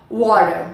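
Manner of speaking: WATER with a flapped T; American pronunciation. Shown as a demonstration. Teacher repeats for students, American WATER without hard T. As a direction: let the T in "Water" vanish into a tap. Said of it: "A pronúncia americana".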